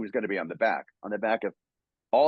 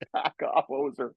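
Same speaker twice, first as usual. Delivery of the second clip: a higher, joking Canadian accent; mock Canadian; 6 semitones higher than usual. Shown as a demonstration. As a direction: play a Canadian Wolverine for laughs. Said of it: "call somebody a hoser".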